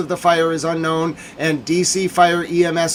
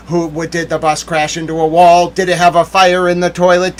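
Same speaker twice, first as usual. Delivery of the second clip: ordinary talk, nothing marked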